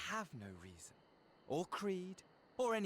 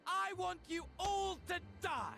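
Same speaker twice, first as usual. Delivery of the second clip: manic villain rant; 8 semitones higher than usual. Acted. Villain raving about killing everyone.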